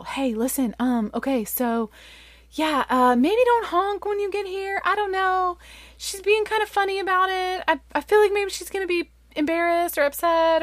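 hesitant start, climbing high and hurried; awkward warning. Retold or imitated. Mom texting guests not to honk, awkward and anxious.

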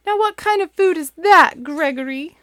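very high, loud puzzled goat mom; Mother Goat. Acted. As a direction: ask in baffled disbelief. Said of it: "she said".

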